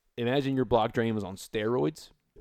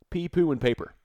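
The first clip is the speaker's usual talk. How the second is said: PSA read; 6 semitones higher, 4 dB louder than usual. Shown as a demonstration. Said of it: "Public service announcement".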